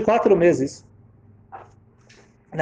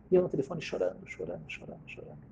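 sinking lower and much softer; fading away; 8 semitones lower, 13 dB quieter, faster than usual. Retold or imitated. Woman alone on the phone crying, fading away. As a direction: let each repeat die away.

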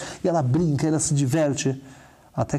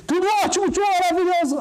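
put-on much higher, bubbly child voice; happy little girl. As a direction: chirp it like a delighted five-year-old.

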